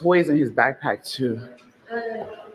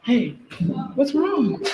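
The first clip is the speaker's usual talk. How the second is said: soothing mom voice; 10 semitones higher, slower than usual. Real, not acted. Mother comforting her crying toddler, high and gentle.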